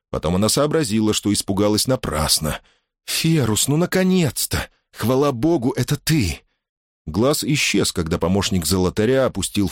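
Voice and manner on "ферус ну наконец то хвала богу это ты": relieved. A narrator's reading. Relieved to find him.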